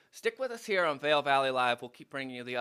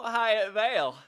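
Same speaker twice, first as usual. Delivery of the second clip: laughing; 6 semitones higher than usual. Real, not acted. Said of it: "I about dumped it all over".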